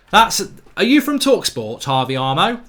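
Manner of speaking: loud, high laugh into the question; laughing outburst. Real, not acted. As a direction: crack up and accuse him.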